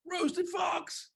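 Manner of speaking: gasping, high-pitched scared squeal; panicked fox. Acted. Fox hears it is the favorite food, panicked. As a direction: play a frightened fox in a high voice.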